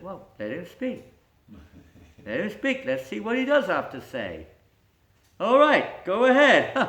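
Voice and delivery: loud, high royal permission; commanding king. Acted. King tells the court to let Srinivas speak, loud and high. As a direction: grant him the floor grandly.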